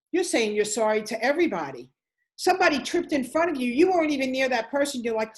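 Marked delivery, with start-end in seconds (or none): none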